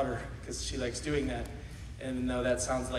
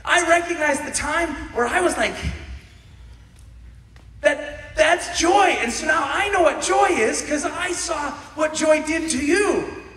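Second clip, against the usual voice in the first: joyful realization; 10 dB louder, slower than usual. Acted. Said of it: "to say, like".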